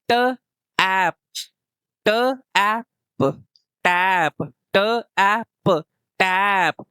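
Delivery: ट, ऐप, टैप sounded out slowly with a short ऐ; short a blending. Shown as a demonstration. Teacher blends टैप for children learning to read. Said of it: "ए किसकी sound दे रहा है".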